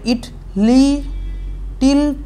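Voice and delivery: "it li-, till" as separate syllables with gaps; cut into syllables. Shown as a demonstration. Teacher showing how the line is cut, "it li-, till" syllable by syllable. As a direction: chop it up: "it", "li-", pause, "till". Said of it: "এটাকে কাটলে এরকম হবে".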